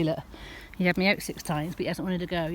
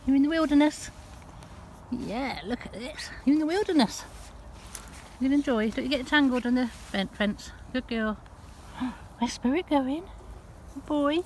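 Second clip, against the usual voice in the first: higher, gentle praise for the dogs; doting pet talk; 5 semitones higher than usual. Real, not acted. Owner praising her dogs out in the wilderness, high doting coo.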